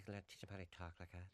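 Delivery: put-on hushed parody of an English-tinged accent; returned-from-England accent. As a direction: quietly mimic someone back from England with an English lilt. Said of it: "coming back talking like that".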